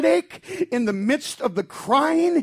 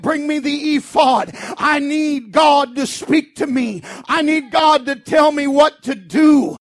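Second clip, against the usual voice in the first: urgent raised cry; 4 semitones higher, 8 dB louder than usual. Acted. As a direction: call out for God's answer. David calls for the ephod.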